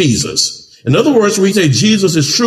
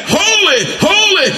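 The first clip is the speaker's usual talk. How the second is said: seraph's ringing shout of "Holy, holy"; angels crying out; 13 semitones higher than usual. Acted. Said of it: "one cried to another and said".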